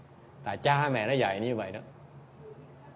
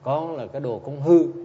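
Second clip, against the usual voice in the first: put-on loud, blunt scolding of a spoiled child; a teacher's loud, stern reproach; 4 semitones higher, 10 dB louder than usual. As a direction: bark the rebuke straight out. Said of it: "Thầy nói thẳng luôn nè".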